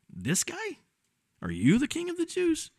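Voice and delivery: high, incredulous squeak of disbelief; mocking Pilate. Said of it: "think from Pilate's perspective".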